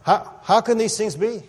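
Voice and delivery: high, puzzled religious scholar asking; a bewildered Pharisee, pitched high; baffled Nick. Retold or imitated. Nick puzzling to Jesus, high and baffled. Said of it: "Nick responds here in verse nine".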